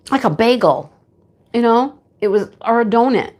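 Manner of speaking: usual speaking voice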